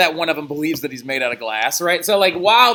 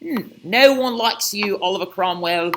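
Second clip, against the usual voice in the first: mock British accent. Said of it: "in your best British accent".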